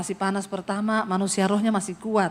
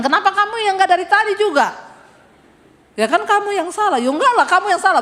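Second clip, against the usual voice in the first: higher and louder, bickering back and forth; mock quarrel; 8 semitones higher, 5 dB louder, faster than usual. Wife and husband bickering in the car, higher and louder. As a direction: replay the spat, half laughing.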